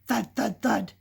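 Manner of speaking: loud, heavy thuds; thud noises. Acted. Reader voicing the chicken's thumping, heavy thuds. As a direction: drop each thud with weight. Reading thudding noises aloud.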